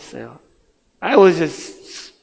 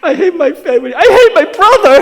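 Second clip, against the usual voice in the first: hammed-up wail, building louder and higher; mock sobbing; 13 semitones higher, 13 dB louder than usual. Miserable teen crying about his family and brother, mock sobbing. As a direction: bawl to a comic peak of misery. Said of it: "pretty miserable, sobbing".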